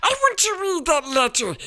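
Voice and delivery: high, loud, fast spoiled demand. A narrator's reading. Spoiled son demanding the letter loudly from his father. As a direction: whine it loudly at your father. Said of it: "he said loudly".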